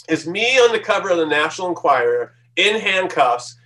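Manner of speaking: usual speaking voice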